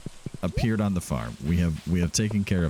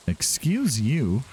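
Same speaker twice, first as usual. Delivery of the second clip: mock offended; 4 semitones higher, much slower than usual. Mock-offended at game crow.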